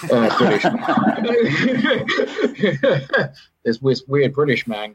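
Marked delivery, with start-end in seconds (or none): 0.1-1.3 says it through a chuckle; laughing at himself while telling it. Real, not acted. Self-mocking retold meeting, laughing. 1.3-3.3 a burst of high-pitched laughter. Real, not acted. Laughs at his own story.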